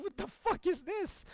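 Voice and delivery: high, loud, slow laughing disbelief; shocked and cracking up at once; shocked wake-up. Retold or imitated. Waking to a scratched nose, laughing in shock. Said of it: "I was like".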